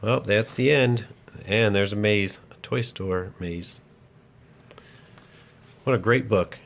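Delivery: ordinary delivery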